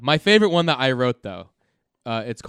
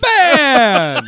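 shout; 11 semitones higher, 10 dB louder than usual. Retold or imitated. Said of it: "everyone's just like".

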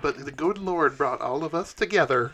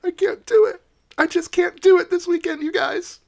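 high, louder pleading whine; whiny Yachty; 5 dB louder than usual. Acted. Yachty refusing the job at the door, high pleading whine. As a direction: beg off in a high tearful whine. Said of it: "And she goes".

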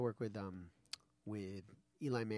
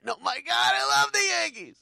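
over-the-top high fan shout; faking Yankees fandom; 14 semitones higher, 12 dB louder than usual. Retold or imitated. Met fan bluffing at an audition, gushing mock shout. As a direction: explode into a hammed-up superfan yell. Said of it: "I had to fake being a fan".

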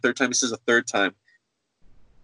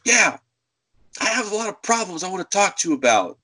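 higher-pitched mock complainer; angry customer; 7 semitones higher, faster than usual. Woman phoning the store with problems, angry. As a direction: launch into a grievance, pitched up. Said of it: "she's angry".

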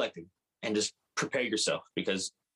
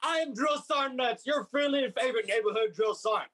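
Drill Sergeant Nuts voice; 10 semitones higher than usual. Acted. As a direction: sign off like a drill instructor. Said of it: "do it in a drill sergeant voice".

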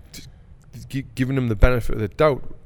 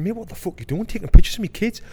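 angry; 9 semitones higher, 7 dB louder than usual. Retold or imitated. Retold angry confrontation quote.

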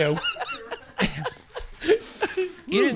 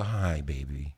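mock seductive; 10 semitones lower, 6 dB quieter, much slower than usual. Shown as a demonstration. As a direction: breathe it out low and soft. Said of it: "Can you say it sexy? Go".

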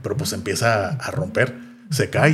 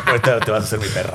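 laughing through it; faster than usual. Retold or imitated. Quoting Negan while laughing.

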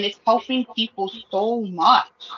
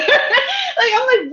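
laughing; 13 semitones higher, 3 dB louder, much slower than usual. Real, not acted. Laughing in disbelief.

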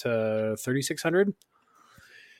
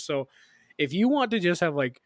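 normal talking voice

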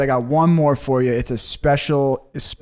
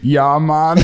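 put-on botched British accent; badly miss a British accent; failed British accent; 3 semitones higher, 4 dB louder than usual. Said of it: "Do a British accent".

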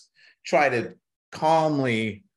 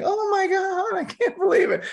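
very high squealing mock gush; imitating gushing wife. Wife gushing at the electrician's claims, squealing mock excitement. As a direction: squeal it like a starstruck fan. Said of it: "my wife's going".

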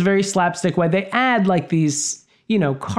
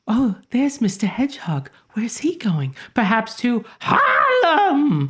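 high, lilting, sing-song fairy voice; 6 semitones higher, slower than usual. Retold or imitated. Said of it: "she says it like".

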